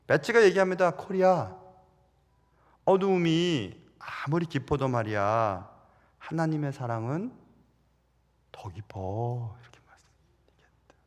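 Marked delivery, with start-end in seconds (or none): 0.9-9.6 tender comfort. Acted. Betsie comforting her sister Corrie. 9.6-10.9 whisper. Real, not acted. Preacher drops to a whisper.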